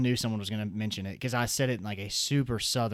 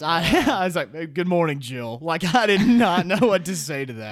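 loud, higher, laughing disbelief; flustered laughing; 5 semitones higher, 8 dB louder than usual. Real, not acted. Flustered laugh retelling awkward greeting.

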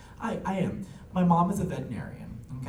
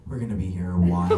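low, quick deadpan aside; dry grandmother; 9 semitones lower, faster than usual. Retold or imitated. Grandmother closing her book before a rant, dryly. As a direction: drop the voice into a dry, imitated put-down. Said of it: "she's very dry".

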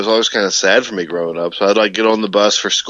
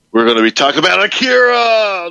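overexcited teaser; 9 semitones higher than usual. Pretend. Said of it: "He's choked up about it".

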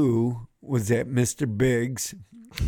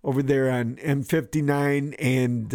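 usual speaking voice

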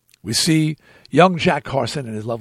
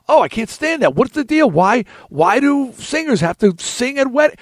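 higher-pitched mock complaint; retold griping, pitched up; mild exasperation; 8 semitones higher than usual. Acted exasperation at wedding singing.

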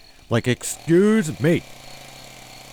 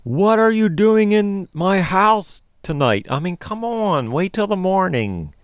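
exasperated complaint; 7 semitones higher, 3 dB louder than usual. Acted. Man confronts aunt at night.

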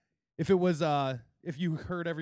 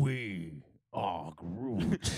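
much lower and very slow, booming; Groot voice; 12 semitones lower, 3 dB quieter, much slower than usual. Retold or imitated. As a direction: rumble it out like a chorus of trees. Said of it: "I am Groot".

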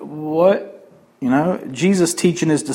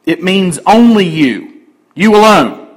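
"only" and "alone" pressed, louder and slower; emphatic you alone; 3 semitones higher, 4 dB louder than usual. Shown as a demonstration. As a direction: lean hard on "you alone" to single them out. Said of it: "in this sentence is emphatic".

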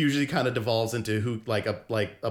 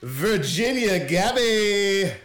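drawn-out, louder and higher game-show announcement; announcer call; 6 semitones higher, 4 dB louder, much slower than usual. Real, not acted. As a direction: announce her name like a host calling out a guest. Host introduces his co-host.

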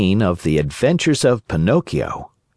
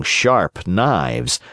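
usual speaking voice

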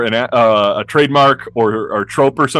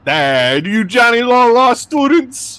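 high, mocking cop voice; sneering cop; 9 semitones higher, slower than usual. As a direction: sneer at them.

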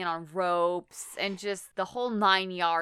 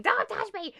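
high-pitched mock scream; 12 semitones higher, 3 dB louder than usual. Wife screams at her husband, high mock shriek. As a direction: imitate a shriek without full volume. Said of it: "And she just starts screaming".

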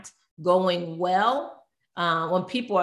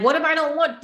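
higher, louder quoted question; imitating worried clients; 9 semitones higher, 5 dB louder, faster than usual. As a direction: voice a client's objection, pitched up. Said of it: "And they're like".

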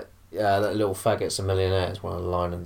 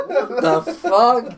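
high slow laughing question; 6 dB louder, much slower than usual. Retold or imitated. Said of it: "the crowd's looking around like".

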